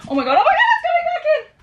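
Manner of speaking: high shrieking laugh-panic; panicked shriek. Real, not acted. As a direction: shriek as the bee returns. Bee returning.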